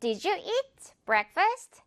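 very slow, "eat" stretched long; slow clear model. Shown as a demonstration. Teacher modelling the sentence very slowly. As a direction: read it slowly, smiling through eat. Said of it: "이렇게 eat 길게 미소로 끌어줘야 돼요".

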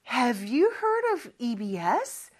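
very slow, rising question for repeating; slow model sentence. Shown as a demonstration. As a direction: spell it out slowly for students. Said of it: "한번 연습을 해볼게요". (아주 천천히 끝을 올리는 시범)

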